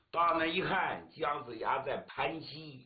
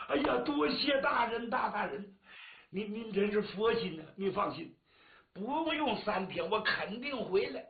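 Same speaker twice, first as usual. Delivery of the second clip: higher, eager gushing thanks; grateful thanks; 6 semitones higher than usual. Acted. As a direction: thank him over and over, relieved. Woodcutter thanks magistrate. (感激涕零地道谢)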